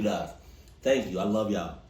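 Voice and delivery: ordinary delivery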